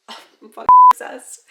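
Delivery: laughing, loud and high; excited laugh. Real, not acted. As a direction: gush through a laugh. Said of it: "Sorry, I'm probably not supposed to swear".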